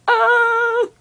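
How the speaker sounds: high, loud startled cry; startled shriek. Real, not acted. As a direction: yelp as the birds swoop. Startled by birds.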